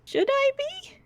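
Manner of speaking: put-on a four-year-old caught off guard; lightly voiced startled little girl; Missy the little girl.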